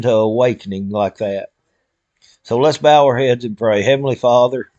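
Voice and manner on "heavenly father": earnest spoken prayer to God; heartfelt prayer. Real, not acted. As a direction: pray aloud for friends and family.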